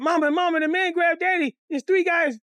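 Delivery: high, urgent cry to Mama; retold child's alarm. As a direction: call out like a frightened kid. Child runs to alert Mama.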